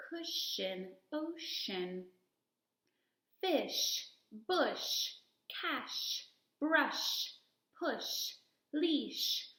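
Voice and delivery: each word said slowly and clearly, the sh drawn out; careful teacher model for listening practice; clear modelling words. Shown as a demonstration. Teacher reading a word list for kids to hear. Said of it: "So just listen".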